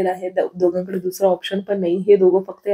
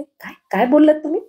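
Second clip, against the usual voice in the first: काय, काय बोललात तुम्ही? pitched much higher, बोललात rising in disbelief; shocked demand; 7 semitones higher than usual. Acted. Wife sitting up in bed, shocked by her husband's grumble. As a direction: jolt awake and fire the question.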